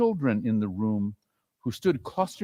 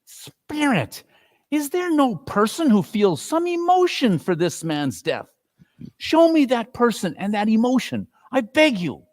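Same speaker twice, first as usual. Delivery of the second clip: put-on higher, begging old man; pleading Scrooge; 8 semitones higher than usual. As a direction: beg the spirit for some feeling. Scrooge begs spirit.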